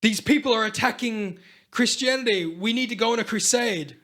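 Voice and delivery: rally the faithful, playfully urgent; a hint of crusade herald; mock call to arms. Acted call to arms at Vatican.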